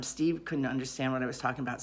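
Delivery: usual speaking voice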